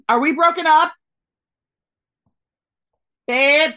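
high, loud shout to a partner; calling out. Real, not acted.

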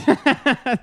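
high, loud burst of laughter; laughing it off. Real, not acted. Laughs at the king story.

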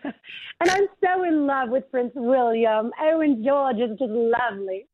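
slow, Southern-tinged fake British; attempted British accent. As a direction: play a British princess badly, stretching the words. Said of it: "in your British accent".

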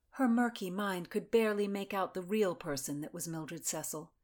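usual speaking voice

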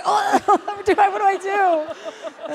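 high, laughing, slowing on "what do I do"; laughing panic. Retold or imitated. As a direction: giggle through the mock panic.

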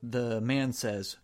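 usual speaking voice

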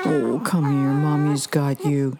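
low, slow, tender comfort; comforting baby. Acted. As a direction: hold and soothe the baby.

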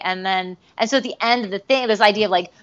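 usual speaking voice

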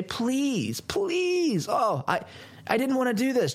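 mock pleading Pelosi. Pelosi mocked begging the generals, hammed-up high whine. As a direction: ham up a tearful plea. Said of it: "this is what she wants to be able to say".

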